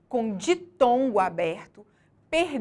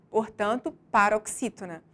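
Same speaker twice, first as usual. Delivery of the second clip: ordinary delivery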